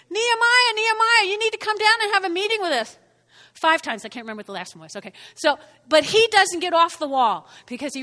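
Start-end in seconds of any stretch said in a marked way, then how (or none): 0.1-3.0 calling out. Acted. Opponents coaxing Nehemiah down off the wall, calling out. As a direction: holler up to lure someone down.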